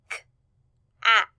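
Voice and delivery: "K-at" split into k, short a, t; sounded out. Shown as a demonstration. Teacher asking class to listen, sounded out. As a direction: say "K-at" sound by sound, long gaps between. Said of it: "Let's listen".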